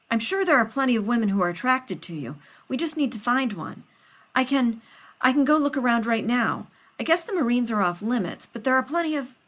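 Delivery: a nervous friend rushing to help; loud and hurried, anxious to please; Rodney, flustered. Acted. Rodney voiced by narrator.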